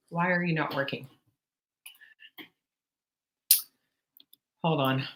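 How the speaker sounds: half-voiced, preoccupied talk to yourself; muttering to self. Real, not acted. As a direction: think aloud under your breath while troubleshooting. Mutters through screen-share trouble.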